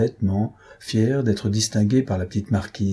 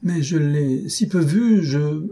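high, slow, hesitant excuse; embarrassed stammer; 7 semitones higher, slower than usual. A narrator's reading. Countess dodging the question about the neighbour, embarrassed faltering. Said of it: "balbutia la comtesse embarrassée".

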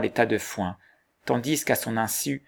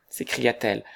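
plain reading voice

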